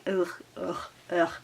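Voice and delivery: soft, mock-disgusted ugh; quiet put-upon groans of disgust. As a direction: groan like it makes your skin crawl. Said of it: "I'm like".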